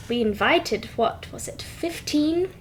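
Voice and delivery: flustered servant whispering; whispered aside. Acted. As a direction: hiss the guest count under your breath. Flustered servants muttering among themselves.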